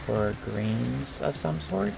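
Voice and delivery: normal talking voice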